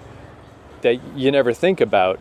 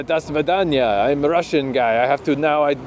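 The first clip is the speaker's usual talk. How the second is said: exaggerated fake Russian accent; mock Russian accent; 3 semitones higher than usual. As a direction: play a jokey Russian guy. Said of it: "what if I was, uh".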